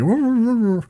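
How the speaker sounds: high "wah wah" babble; muffled-speech imitation. Fan talking through a fursuit head, imitated as muffled wah-wahs. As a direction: imitate unintelligible speech from inside a costume. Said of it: "he was wearing a suit, so it was like".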